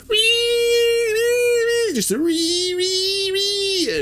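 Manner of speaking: super high-pitched "wee" squeals; trapped bunny squeal. Retold or imitated. Screams like a bunny stuck in a trap, shrill squealing. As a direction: squeal repeatedly like a snared rabbit. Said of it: "sounded like a bunny stuck in a trap".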